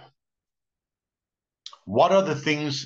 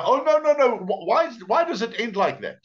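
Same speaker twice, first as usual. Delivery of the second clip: high, stammered protest at a story's ending; hammed-up wail of disbelief; mock dismay; 12 semitones higher, 4 dB louder than usual. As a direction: imitate an upset listener, pitched way up. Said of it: "And you go".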